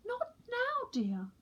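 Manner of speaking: high, gently dismissive mum; mother penguin voice. A narrator's reading. Mother penguin tells chick to wait, high and kind. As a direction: brush the chick off sweetly. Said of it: "said Missus Pilchard Brown".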